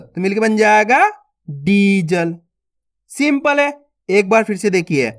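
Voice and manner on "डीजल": "डीजल" with a long first ee, lower; drawn-out vowel. Shown as a demonstration. Teacher blending the letters for learners, drawn-out "डीजल". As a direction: stretch the ee in "डीजल". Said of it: "खींच के पढ़ेंगे कैसे?".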